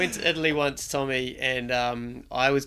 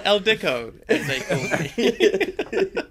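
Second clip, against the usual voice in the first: mock grand title; 7 semitones higher, 3 dB louder, much slower than usual. Jokey self-nickname.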